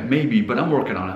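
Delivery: usual speaking voice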